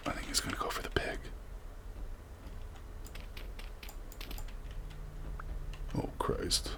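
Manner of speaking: very soft, near whisper; hushed suspense. Real, not acted. Player watching a predator stalk the pig, hushed suspense. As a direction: breathe it nervously under your breath.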